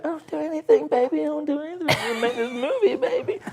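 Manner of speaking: high, loud mock-begging that cracks into a laughing sob on baby; desperate pleading. Retelling his movie pitch to investors, high hammed-up pleading. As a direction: beg the money men shamelessly, voice soaring up. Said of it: "I'm desperate, and I'll do anything".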